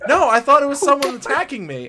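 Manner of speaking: ordinary delivery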